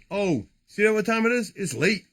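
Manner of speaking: normal talking voice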